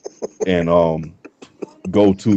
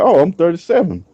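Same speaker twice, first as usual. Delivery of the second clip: higher, mock-impressed reply; mock surprise; 8 semitones higher than usual. Telling the girl his age, higher mock surprise. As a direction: play it mock-impressed, pitched up. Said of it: "I said".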